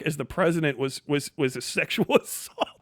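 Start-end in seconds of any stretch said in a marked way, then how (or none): none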